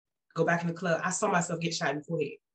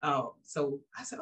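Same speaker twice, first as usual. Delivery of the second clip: usual speaking voice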